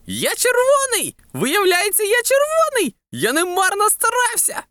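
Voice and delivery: high-pitched, jubilant watermelon voice; overjoyed. A narrator's reading. Watermelon discovering it is red inside, overjoyed. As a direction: exult high, melting with joy. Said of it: "танув від радості".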